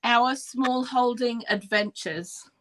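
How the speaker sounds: channel name spaced out slowly; slow, careful repeat. Shown as a demonstration. Guest repeating the channel name on request, slow and careful. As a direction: say it slowly for someone taking it down. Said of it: "give me the name again".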